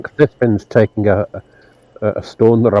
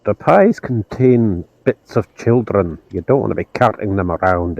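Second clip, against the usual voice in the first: slow solemn; slower than usual. Acted. Priest justifies destroying pie.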